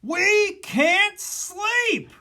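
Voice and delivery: slow, high, loud wail; loud complaint. Acted. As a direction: drag out the protest. Animals' complaint continues.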